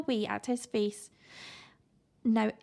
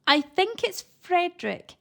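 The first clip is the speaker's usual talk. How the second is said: hissed; 7 semitones higher, 4 dB louder than usual. A narrator's reading. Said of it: "hissed Eric".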